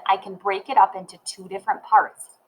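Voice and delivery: ordinary delivery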